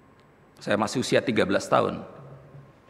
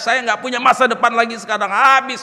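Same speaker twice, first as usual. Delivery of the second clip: loud, high, anguished outcry at God; angry crying; 12 semitones higher, 9 dB louder than usual. Retold or imitated. Bereaved son railing at God alone in bedroom, angry crying. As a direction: retell a furious, grief-stricken tirade. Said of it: "Marah, nangis".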